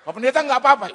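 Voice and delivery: high, loud retold check; loud concerned call. Driver checking on the fallen pastor, loud call. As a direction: call out to check he's fine.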